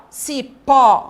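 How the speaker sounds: CIPÓ with an open, loud final Ó. Shown as a demonstration. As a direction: stress the wide-open Ó ending CIPÓ. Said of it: "O ó foi aberto".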